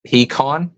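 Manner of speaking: pecan said pee-KAHN, rising questioningly; pecan with long ee and open ah; PEE-kahn pronunciation. Shown as a demonstration. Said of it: "maybe there's another option there".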